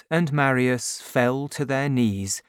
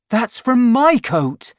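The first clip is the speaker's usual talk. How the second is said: much higher and louder, stunned; startled recognition; 10 semitones higher, 7 dB louder than usual. A narrator's reading. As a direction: gasp it out as you recognize the cloth. Marius recognizes his coat.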